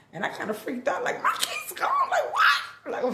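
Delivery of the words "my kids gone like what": high squealing panic, laughing through it; shrieking alarm with a laugh; shocked disbelief. Retold or imitated. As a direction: replay the moment the news hit. Panicked retelling of kids missing.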